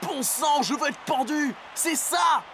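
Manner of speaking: high, shouted eureka; excited realization. Acted. Player realizing the triplets swapped shirts, high excited shout. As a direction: burst out as the answer hits.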